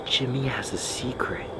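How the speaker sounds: quiet eerie whisper; hushed whisper. Real, not acted. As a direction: whisper the words back, awed. Repeats spirit box words.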